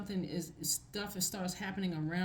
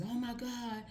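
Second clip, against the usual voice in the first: fake stressing; 5 semitones higher, slower than usual. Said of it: "And you know what fake stressing is".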